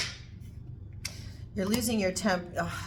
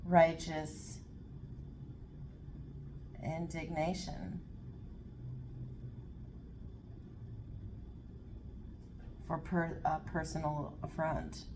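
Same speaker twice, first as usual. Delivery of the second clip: righteous indignation spoken slowly and trailing softer, word by word; slow dictation; 2 semitones lower, 9 dB quieter than usual. Real, not acted. Reader writing the phrase down, slow dictating. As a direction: say it as you write it down. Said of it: "I have to write that down".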